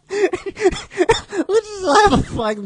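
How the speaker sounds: high, squeaky talk through laughter; helpless giggly speech; laughing. Real, not acted. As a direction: crack up while slowly getting the words out. Cracks up at own acted line.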